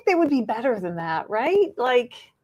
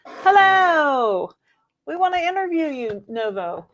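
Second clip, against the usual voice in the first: mock-grand hello; 5 semitones higher, 6 dB louder, slower than usual. Greeting lawyers, loud long.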